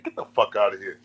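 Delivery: usual speaking voice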